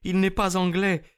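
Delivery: Anna giving herself up to Holmes, dignified; Russian woman Anna. Acted. Narrator voices Russian woman.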